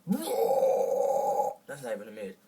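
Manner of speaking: loud breathy scream demo; false chord scream. Shown as a demonstration. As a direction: roar it out from the throat. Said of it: "take the mid".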